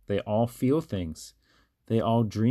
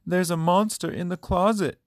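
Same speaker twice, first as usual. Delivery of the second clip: high, louder scared cry; frightened cry; 10 semitones higher, 4 dB louder, faster than usual. A narrator's reading. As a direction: call out in fright from a bad dream. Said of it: "Carmen cried".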